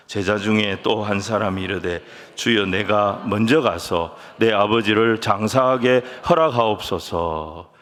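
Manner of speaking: measured, low-pitched reading aloud; scripture reading. Acted. Pastor leading the congregation in reading a verse, measured scripture reading. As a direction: read it out steadily for everyone to follow.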